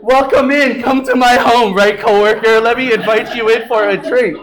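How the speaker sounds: mock welcome. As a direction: ham up the polite host act. Mom greets coworker, mocked.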